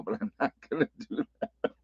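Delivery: real laugh breaking into the words; trailing off into a chuckle. Real, not acted. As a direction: soften into laughter at your own joke. Laughs at own joke.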